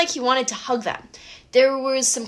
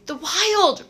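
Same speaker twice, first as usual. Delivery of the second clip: moaning; 9 semitones higher than usual. A narrator's reading. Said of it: "Grover moaned".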